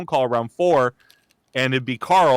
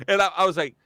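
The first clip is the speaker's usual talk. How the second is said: ordinary talk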